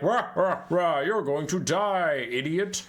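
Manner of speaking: growly, roaring mock-villain threat; mock monster taunt. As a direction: roar it like a cartoon monster. Villain's taunt in retold story.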